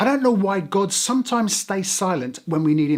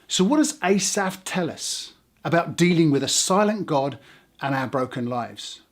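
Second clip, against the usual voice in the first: ordinary talk, nothing marked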